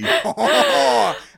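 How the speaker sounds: loud, high "oh-ho-ho" laugh; hearty theatrical laugh. Acted. Shopkeeper delighted by fellow speaker, hearty laugh. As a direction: boom out a delighted stage laugh.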